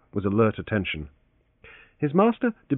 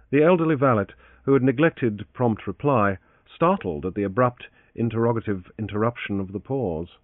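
ordinary delivery

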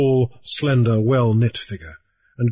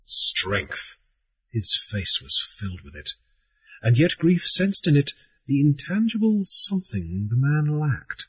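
usual speaking voice

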